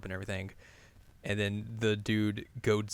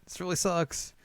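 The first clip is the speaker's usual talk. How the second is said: higher-pitched mock dejection; bummed out, imitated; 7 semitones higher, slower than usual. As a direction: whine it a little, playing let down. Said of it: "he's real bummed about that".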